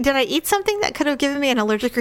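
normal talking voice

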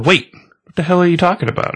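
high, fast panicked voice; panicked guy. Retold or imitated. Guy to the reaper, high and fast in panic.

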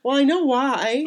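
high, slow, tearful admission; choked up, drawing the words out; emotional. Real, not acted. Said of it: "getting so emotional".

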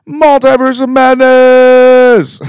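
hyped title call. Real, not acted. A fan hyping the next movie, a stretched announcer call.

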